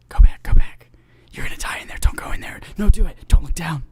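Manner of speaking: urgent, creepy whispered warnings; hissed, unsettling whisper; whispering head voices. Acted. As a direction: whisper like competing voices inside someone's head. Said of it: "these voices in your head whispering".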